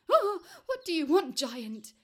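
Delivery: high, loud, startled cry; frightened man confronted by a giant; shaking with fright. Acted. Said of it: "shaking with fright".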